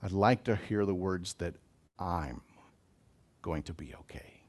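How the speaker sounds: quiet, gentle first-person reassurance; hushed, tender promise to oneself; soft self-assurance. Shown as a demonstration. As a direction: say it softly to yourself, as comfort. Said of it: "where we really settle".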